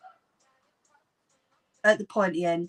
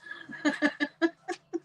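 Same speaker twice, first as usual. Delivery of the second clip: laughing; 7 semitones higher, 4 dB quieter than usual. Real, not acted. Laughing at own name mix-up.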